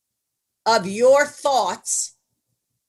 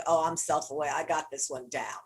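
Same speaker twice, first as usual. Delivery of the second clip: smug self-assurance; 8 semitones lower, 8 dB quieter than usual. Retold or imitated. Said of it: "most of you think".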